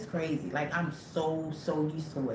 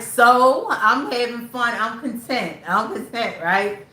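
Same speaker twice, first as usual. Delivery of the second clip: mock cheerful reply; 6 semitones higher, 7 dB louder than usual. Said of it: "I was like".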